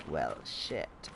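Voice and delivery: low, quiet mock-dramatic "well, shit"; reading out quest name. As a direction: drop the voice low for the quest title. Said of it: "it's more like".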